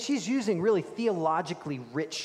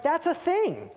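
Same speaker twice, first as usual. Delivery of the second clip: high, louder retold disbelief; thrown; 11 semitones higher, 5 dB louder, slower than usual. Preacher recalls seeing a dog in a stroller, retold amazement. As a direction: pitch it way up, mock-astonished at the sight. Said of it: "it threw me".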